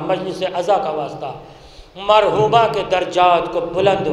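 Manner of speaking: hushed closing dua; prayer. Acted. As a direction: pray quietly for the departed. Closing dua after majlis.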